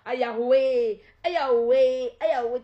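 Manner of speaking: loud drawn-out cry of Yahweh; hammed-up loud lament; mock lament. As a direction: wail it in mock despair.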